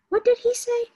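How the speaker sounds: put-on high, loud little kid asking; piping child's question. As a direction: squeak it like a puzzled child.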